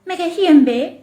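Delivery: mocking echo. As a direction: parrot his words back to tease him. Attendant mockingly echoes the passenger. (pramugari meniru ucapan penumpang dengan nada mengejek)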